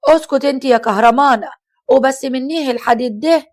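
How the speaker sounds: loud, furious rebuke; angry. Acted. Mother-in-law angrily silences Kahramana, loud. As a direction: shout her down. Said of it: "ردت عليها حماتها بغضب".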